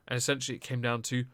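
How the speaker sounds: ordinary talk, nothing marked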